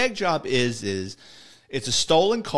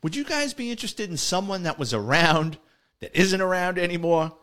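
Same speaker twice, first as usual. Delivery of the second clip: higher, drawn-level informant's pitch; lightly voiced informant floating an offer in a higher pitch; Tiny's sly offer; 7 semitones higher than usual. Informant feeling out two cops with an offer, lightly imitated. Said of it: "he said it just like this".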